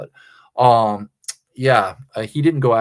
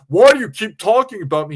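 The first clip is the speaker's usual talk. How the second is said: high loud whiny mock voice; 8 semitones higher, 7 dB louder than usual. Streamer imitating JT whining at CB. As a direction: whine it like a spoiled brat. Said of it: "chastising C B".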